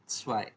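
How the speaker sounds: स्वाई opening on a sharp ts; German zwei. Shown as a demonstration. Teacher repeating the answer for two, स्वाई with sharp ts. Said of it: "इसको क्या बोलेंगे".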